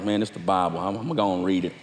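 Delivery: low, quiet, half-hearted resolve; mock resolve. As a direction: mutter it like a tired promise to yourself. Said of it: "we already like".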